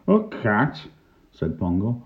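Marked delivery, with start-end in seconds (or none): 0.1-0.9 high-pitched disbelieving echo; incredulous query. A narrator's reading. Pongo doubts Clem's story, high and incredulous.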